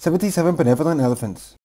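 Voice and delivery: rattled off at top speed; very fast. Shown as a demonstration. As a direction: blur the tongue twister together. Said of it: "Say the tongue twister faster and faster and faster".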